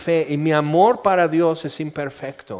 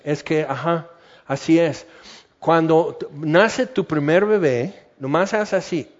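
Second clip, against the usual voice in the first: normal talking voice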